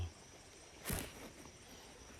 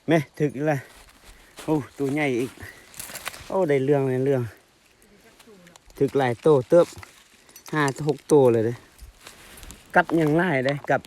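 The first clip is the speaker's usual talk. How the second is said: loud, higher-pitched, thrilled; excited exclaiming; 5 semitones higher, 9 dB louder than usual. Real, not acted. Angler counts five or six fish caught, loud excitement. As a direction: call out the catch with real excitement. Several big fish biting.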